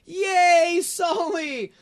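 high, delighted little cheer; excited baby voice. Retold or imitated. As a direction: cheer like a thrilled toddler. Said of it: "he was like".

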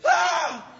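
high, loud terrified "ah"; panicked scream. Retold or imitated. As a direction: scream as if about to fall. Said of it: "they freak out".

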